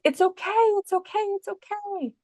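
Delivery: high, fretful mock parent voice repeating it's okay; high-pitched hovering parent, hammed-up; anxious soothing parent. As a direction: imitate a flustered parent over-comforting a crying child. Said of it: "if you're like".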